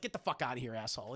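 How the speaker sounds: understated, low tough-guy dismissal; low, quiet menace. Acted. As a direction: brush him off darkly. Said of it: "he's like".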